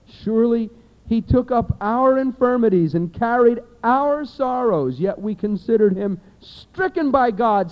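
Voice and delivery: fervent pulpit reading of scripture. Acted. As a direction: proclaim the passage, building in force. Preacher reads Isaiah aloud.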